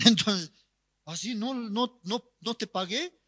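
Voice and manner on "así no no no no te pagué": lightly voiced drunk, high and soft; higher-pitched, doubtful protest of a tipsy man; puzzled drunk insisting, lightly acted; drunk young man. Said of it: "El, este joven borracho".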